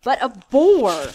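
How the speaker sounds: slow, loud, rising protest; voice running up. Acted. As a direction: let the voice climb in protest. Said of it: "Ralph's voice ran up".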